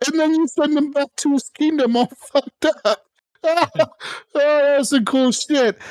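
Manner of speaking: real laughter rising high through the words; cracking up, delighted with his own cruelty; laughing glee. Real, not acted. Gamer laughing to his friends about blinding the heir. Gleeful over his brutal move.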